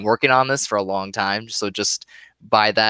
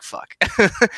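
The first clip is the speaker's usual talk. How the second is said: mock pained reaction; 10 semitones higher than usual. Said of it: "you're like".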